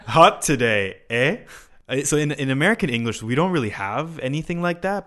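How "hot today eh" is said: "Hot today, eh?" louder, with a friendly tag "eh?" at the end; Canadian eh. Shown as a demonstration. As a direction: lean on "eh?" as a casual Canadian tag. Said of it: "in Canada, they say, like".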